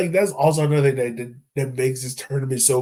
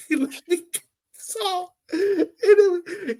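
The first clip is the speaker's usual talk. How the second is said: high, near-crying laughter; helpless teary laughter; laughing hard; much slower than usual. Real, not acted. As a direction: lose it completely. Cracking up over the celebration.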